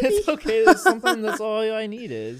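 very high, loud laughing voice; laughing reassurance. Real, not acted. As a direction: squeal it through laughter. Laughing as friend admits crying.

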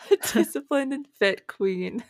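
laughing. Real, not acted. Laughing through the compliment.

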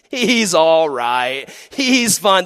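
high, loud, slow mock reassurance; dismissive dog owner. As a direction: brush off the bite. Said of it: "They're like".

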